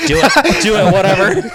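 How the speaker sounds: high, loud, laughing "do it"; laughing go-ahead. Retold or imitated. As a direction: wave him on through laughter. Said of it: "I'm like".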